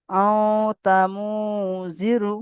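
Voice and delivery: আও তা মু drawn out very slowly through the nose, then জিরু; slow nasal recitation. Shown as a demonstration. Teacher reciting slowly for students to copy, nasal. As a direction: stretch আও তা মু with a long nasal hum. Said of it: "নাকে তুলে একালি পরিমাণ গুণ না করে পড়তে হবে".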